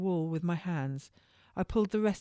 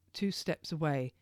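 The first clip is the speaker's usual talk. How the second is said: ordinary delivery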